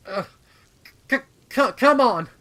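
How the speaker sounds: straining cry. Acted. Gagging while pulling her sister.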